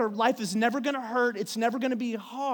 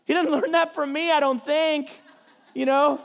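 usual speaking voice

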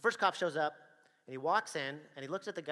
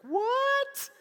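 high, loud shocked squeal; wife hearing the news on the phone, shocked; 13 dB louder than usual. Retold or imitated. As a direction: squeak it out in disbelief. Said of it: "And she's like".

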